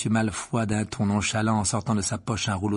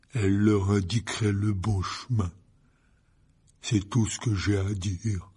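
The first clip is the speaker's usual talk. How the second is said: slow, each word separated; words detached; slower than usual. A narrator's reading. Hagrid slowly drops a clue for Harry and Ron. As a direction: spell out the hidden clue. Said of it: "en détachant bien ses mots".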